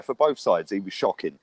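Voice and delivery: ordinary delivery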